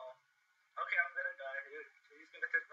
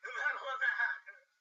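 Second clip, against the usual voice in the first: laughing; 6 semitones higher, 5 dB louder than usual. Real, not acted. Laughing repeat of phrase.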